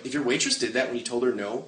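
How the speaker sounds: normal talking voice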